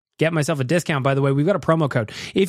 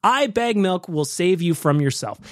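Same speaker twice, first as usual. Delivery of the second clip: normal talking voice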